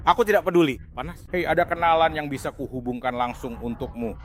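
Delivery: very low, quiet growly voice; deep slowed voice. Acted. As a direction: drop it low and soft like a villain. Low put-on voice.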